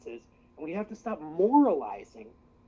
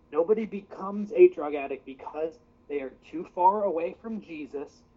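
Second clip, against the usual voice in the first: ordinary talk, nothing marked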